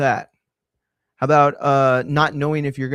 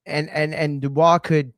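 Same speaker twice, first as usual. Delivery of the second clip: ordinary talk, nothing marked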